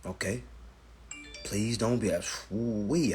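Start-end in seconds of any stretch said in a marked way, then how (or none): none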